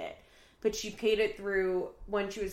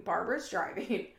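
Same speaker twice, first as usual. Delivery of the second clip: ordinary talk, nothing marked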